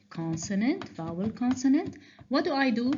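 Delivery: ordinary talk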